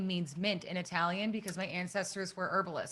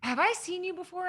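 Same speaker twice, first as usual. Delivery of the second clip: higher-pitched retold stranger's question; imitated store woman; 11 semitones higher than usual. Store woman approaching a lost beginner, imitated high questioning voice. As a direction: quote a curious stranger, pitched up. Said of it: "She was like".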